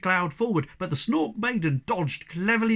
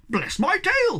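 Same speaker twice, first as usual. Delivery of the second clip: higher, louder startled exclamation; bursting out; 6 dB louder, slower than usual. Acted. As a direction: blurt it in surprise. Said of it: "he burst out".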